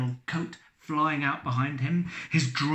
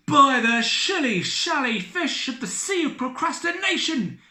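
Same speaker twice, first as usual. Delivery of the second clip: high, loud ecstatic cry; overjoyed cry; 12 semitones higher, 5 dB louder than usual. Acted. Captain overjoyed to see Elizabeth, high cry. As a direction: cry out in joy. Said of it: "he cried".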